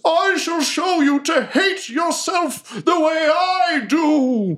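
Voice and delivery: high, slow, theatrical villain shout; menacing villain shout. Acted. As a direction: proclaim it like a doomed dark knight. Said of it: "He says".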